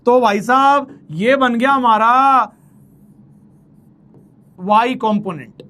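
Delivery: ordinary talk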